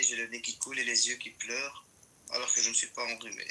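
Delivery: usual speaking voice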